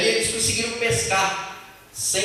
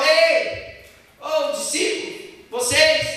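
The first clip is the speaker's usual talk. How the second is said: high-pitched hail to people far off; mock calling out; 6 semitones higher, slower than usual. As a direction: call out casually to grab their attention. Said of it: "e gritou". (chamando de longe, em tom agudo)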